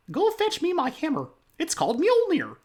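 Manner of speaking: very high squeaky cartoon mouse; Mickey Mouse voice. Shown as a demonstration. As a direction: demand the hammer in a tiny mouse voice. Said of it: "I don't do Mickey very well".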